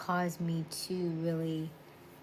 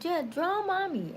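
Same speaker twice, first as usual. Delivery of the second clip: high-pitched mock parent voice; playful parent; 12 semitones higher than usual. Parent telling kids to draw mommy, playful high voice. Acting parent talking to children.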